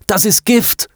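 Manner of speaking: high-pitched urgent warning cry; gebrüllter Warnruf. A narrator's reading. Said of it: "brüllte er". (hoher, dringlicher Warnruf)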